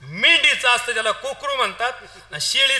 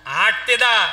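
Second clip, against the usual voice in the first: high, loud public proclamation; village crier; 8 semitones higher, 7 dB louder than usual. Acted. Crier announces the feast invitation to all the village, loud proclamation. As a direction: call it out to the whole village. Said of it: "सगळ्या गावात दवंडी द्या".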